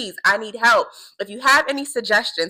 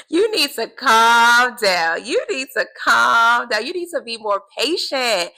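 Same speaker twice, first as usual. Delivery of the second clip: slow, higher, firmer voice of God calling her to calm; a higher, slower rebuke from above; God's voice telling her to slow down; God gently correcting; 4 semitones higher, 4 dB louder, slower than usual. Retold or imitated. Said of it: "the Lord has been like".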